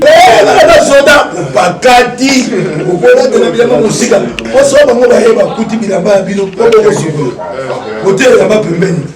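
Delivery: loud call to the crowd; shouting out loud. Real, not acted. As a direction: belt it out over the noise. Calls out to the crowd. (voix forte qui interpelle)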